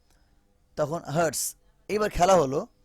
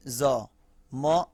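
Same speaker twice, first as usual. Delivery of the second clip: usual speaking voice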